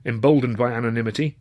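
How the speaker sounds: plain reading voice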